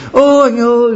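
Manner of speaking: widow's mock cry at the grave; mock wailing widow. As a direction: wail for the husband, hammed-up. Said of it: "vậy là cô này".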